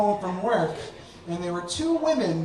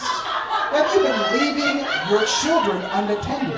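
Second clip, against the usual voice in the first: higher, slow, officious caseworker; stern official; 6 semitones higher, 4 dB louder than usual. Retold or imitated. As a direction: question him like an official. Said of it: "I'm from Child Protective Services".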